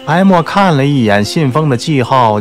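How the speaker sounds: plain reading voice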